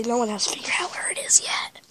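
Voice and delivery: secretive whisper. Real, not acted. Secret candy spot, hushed.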